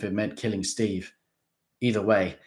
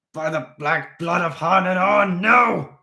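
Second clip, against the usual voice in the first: loud high roar building to a shout; 10 semitones higher, 10 dB louder, slower than usual. A narrator's reading. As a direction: roar the oath. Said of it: "Mister Krebsley roared".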